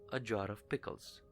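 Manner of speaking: usual speaking voice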